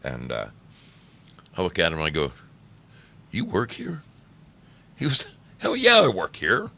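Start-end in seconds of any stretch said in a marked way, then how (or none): 3.3-4.0 mock surprised question. Retold question to long-haired guy. 5.6-6.7 imitating friend's loud reply.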